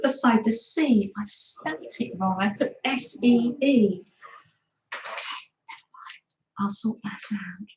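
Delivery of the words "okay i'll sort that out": whispered aside to herself. Real, not acted. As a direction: breathe it with no voice, just air. Fixing her spelling mistake.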